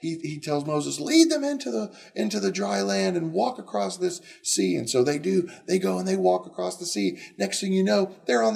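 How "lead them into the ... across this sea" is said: much higher directive; higher, firm instruction; commanding voice. Acted. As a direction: tell Moses what to do.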